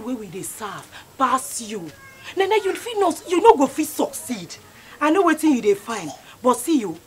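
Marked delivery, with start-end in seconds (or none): 2.4-4.6 angry taunt. Acted. Taunting Nene.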